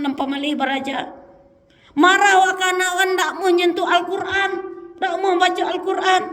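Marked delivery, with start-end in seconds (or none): 2.0-3.2 much higher, louder repeat of the phrase; angry raised-voice repeat. Shown as a demonstration. Repeats phrase, second time shouted. 3.2-6.2 much higher, louder scolding; hammed-up angry rant; mock scolding.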